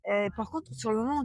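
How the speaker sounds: ordinary delivery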